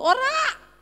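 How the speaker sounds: high, loud retort; angry-sounding denial. Retold or imitated. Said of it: "Itu nadamu nesu loh". (bantahan bernada marah)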